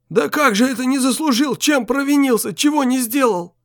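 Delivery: put-on high, pleading old man. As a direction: plead with her.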